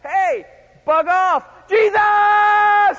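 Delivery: high, drawn-out defiant yell; full-volume holler, stretched at the end; ear-splitting shout. Acted. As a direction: shout back, then cry out desperately. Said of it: "just lost his hearing".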